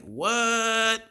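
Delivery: high, drawn-out mock disbelief; mock stunned. Groom surrounded by strippers, mock stunned. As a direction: stretch it high like a stunned man. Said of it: "He's got his face like".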